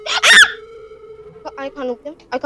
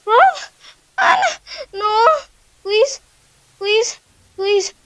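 high, whimpering please; frightened pleading; 7 semitones higher, slower than usual. Real, not acted. Gamer begging the chasing killer, high and whimpering. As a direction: beg for your life, close to crying.

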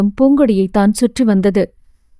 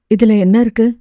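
ordinary delivery

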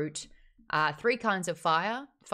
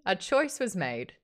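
usual speaking voice

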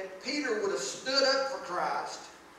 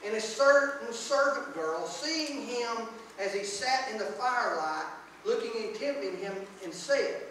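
scripture reading; 4 dB louder than usual. Acted. Reads the scripture passage aloud.

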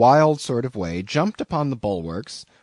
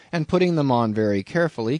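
ordinary delivery

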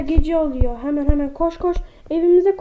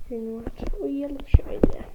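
whisper; 5 dB quieter than usual. Real, not acted. Whispering to viewers as the character goes to bed. Going to sleep in game.

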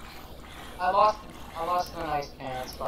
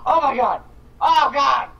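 high, loud startled scream; real gamer panic scream; panicked screaming; 9 semitones higher, 9 dB louder than usual. Real, not acted. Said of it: "Sorry, I screamed".

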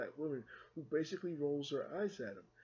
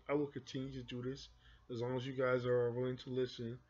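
usual speaking voice

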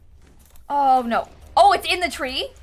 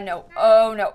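ordinary talk

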